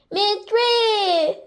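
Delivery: high, drawn-out cheer; eager chiming in. A narrator's reading. Rabbit joining the hungry friends, eager high cheer. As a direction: pipe up keenly, stretching it.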